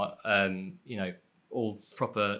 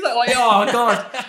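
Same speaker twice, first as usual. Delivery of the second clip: cringing laugh; 13 semitones higher, 9 dB louder, much slower than usual. Real, not acted. Cringing at own performance.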